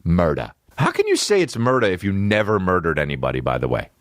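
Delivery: ordinary talk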